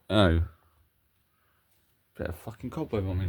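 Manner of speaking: ordinary talk, nothing marked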